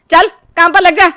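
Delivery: high, loud command; harsh order. Acted. Mother-in-law orders the bride back to work, loud and high. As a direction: snap her back to work.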